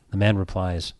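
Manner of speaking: ordinary delivery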